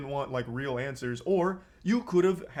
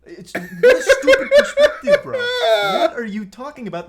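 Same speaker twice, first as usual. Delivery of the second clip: laughing disbelief; 11 semitones higher, 13 dB louder than usual. Real, not acted.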